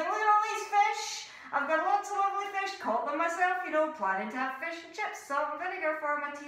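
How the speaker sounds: high, loud showy bragging; loud smug fox; loud boasting. Acted. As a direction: announce it to everybody loudly. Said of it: "walked loudly past everybody, going".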